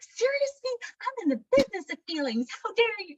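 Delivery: high, loud outraged outburst; hammed-up offended squawk; mock indignation. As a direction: act out an affronted inner protest. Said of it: "I didn't say any of that".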